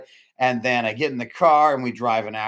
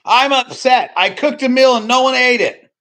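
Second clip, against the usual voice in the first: much higher, louder whining complaint; mock whiny shout; 14 semitones higher, 10 dB louder than usual. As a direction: wail it like a spoiled grumbler. Mocking upset complainer.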